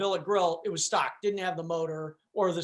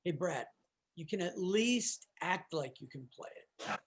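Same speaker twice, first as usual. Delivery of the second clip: much softer, lower, confiding aside; teasing undertone, kept very soft; playful whisper; 3 semitones lower, 10 dB quieter than usual. Retold or imitated. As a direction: lean in with a hushed, playful tease. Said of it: "he kinda playfully whispered, he goes".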